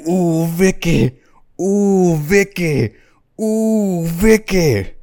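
high, drawn-out, slow cooing; mock lustful moaning. Macker busy under the table, mock lustful moaning. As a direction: moan the name in hammed-up ecstasy.

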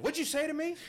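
high, loud hostile demand; old man snapping at a stranger; angry old man. Retold or imitated. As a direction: challenge him like he was insulted. Old man confronting him.

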